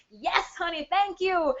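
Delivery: high, gushing thanks; delighted thanks. Real, not acted. Coach thanks a viewer who loves her accent, delighted. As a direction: gush back at a compliment.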